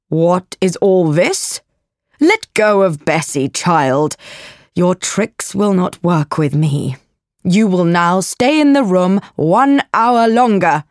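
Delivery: measured, commanding guardian; cold, strict scolding; stern aunt. Acted. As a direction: lay down the punishment, allowing no argument. Voices stern aunt Missus Reed.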